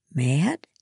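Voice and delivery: rising, baffled query; puzzled. A narrator's reading. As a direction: echo the word as a confused question. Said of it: "I was puzzled".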